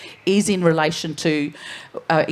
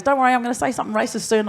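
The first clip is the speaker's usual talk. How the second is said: joking farmer; 6 semitones higher, much faster than usual. Retold or imitated. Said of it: "and he goes".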